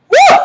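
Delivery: high, loud "woo"; swooning whoop. Real, not acted. Woman raving about a man's accent, swooning whoop. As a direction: let out a sudden high whoop.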